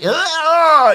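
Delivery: short high, loud scream; mock rage scream. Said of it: "and, like, scream at".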